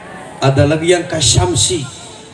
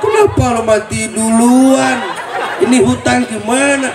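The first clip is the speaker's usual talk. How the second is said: a hint of a wailing widow, higher; mock grieving widow; 7 semitones higher than usual. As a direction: lightly wail at the dead husband. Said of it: "Nangisnya begini".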